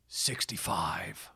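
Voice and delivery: slow, low stage whisper; ominous whispered title reveal; hushed dramatic reveal. Acted. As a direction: whisper it like a movie title, drawn out.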